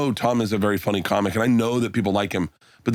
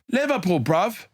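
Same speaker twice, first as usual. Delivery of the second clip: higher-pitched imitation of Liverpool accent; 6 semitones higher than usual. Audience guy answering the comic, Liverpool accent. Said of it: "the guy goes".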